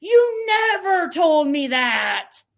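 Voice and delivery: high, loud, slow hammed-up whine; imitating a melodramatic accusation; dramatic mock wail. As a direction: wail it like an overwrought friend. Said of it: "It was so dramatic".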